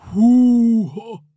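loud, high startled "Húha!"; a scared yelp at his own feet; a fright at swapped feet; frightened gasp. Acted. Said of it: "motyogta rémülten".

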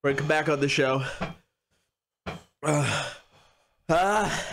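usual speaking voice

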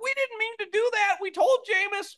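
high, whiny excuse-making voice; mock excuse. Host mocking the Saints' excuses, high and whiny. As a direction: whine like a team caught out. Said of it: "the Saints were like".

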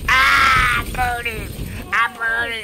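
loud, high hammed-up wail; mock agony. Player voicing a burning figure, loud mock agony. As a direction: wail as if on fire.

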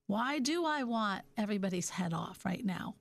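ordinary talk

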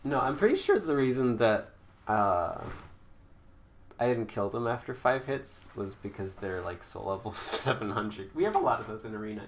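usual speaking voice

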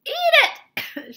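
very high, loud excited order; shouted. A narrator's reading. Wonka urging Charlie to eat, shouted. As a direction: shout it at the top of the voice. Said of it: "shouted Mister Wonka".

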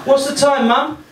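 put-on high-pitched little kid voice; small child. Small child asking Mum while she fries onions, small child voice. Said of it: "a small child asks you the time".

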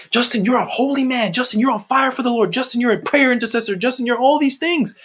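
high, piled-up flattery, imitated; imitating admirers. Mimics everyone's praise of him.

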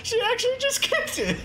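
high laughing squeal; laughing disbelief. Real, not acted. Amazed laughing reaction.

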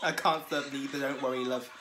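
playful put-on northern English accent; jokey northern English voice. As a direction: slip into northern English, laughing. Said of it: "Why did I go to a northern".

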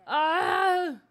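loud, high cry of overwhelm; frazzled yell. Real, not acted. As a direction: let out a loud, exasperated "ah". Overwhelmed by game chaos.

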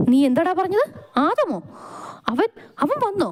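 very high, alarmed questions; afraid. A narrator's reading. Boss learns that the feared man is back, afraid. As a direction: stammer in rising dread. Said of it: "നെഞ്ചിൽ പേടി തോന്നി തുടങ്ങി".

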